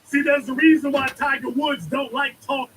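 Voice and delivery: normal talking voice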